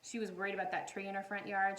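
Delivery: usual speaking voice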